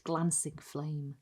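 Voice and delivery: usual speaking voice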